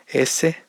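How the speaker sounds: "S" said toward sh, like "eshe"; retracted, shushing s in "S"; Spanish S. Shown as a demonstration. Teacher showing how Spain says it, "S" with sh-like sound. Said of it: "un sonido más sh".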